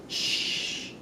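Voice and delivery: long unvoiced shush; drawn-out "shh" to silence someone; hushing. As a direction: imitate urgently shushing noisy friends. Hushing the girls mid-event, retold.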